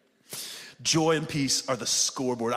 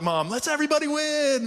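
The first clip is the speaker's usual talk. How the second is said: mock everybody-wins cheer; 11 semitones higher than usual. Preacher imitating his harmony-loving daughter and wife, mock cheer. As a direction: stretch it high like a gushing peacemaker. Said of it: "she's a little more like".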